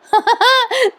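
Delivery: high-pitched giggling; short delighted laugh; real laugh. Real, not acted. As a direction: burst out in amused high giggles. Laughs at suitor's promise.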